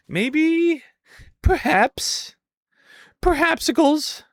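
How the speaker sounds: high, playful sing-song; lightly cartoonish; silly voice. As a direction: goof around with the words. Playful word silliness.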